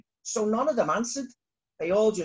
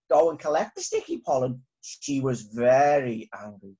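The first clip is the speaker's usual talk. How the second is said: usual speaking voice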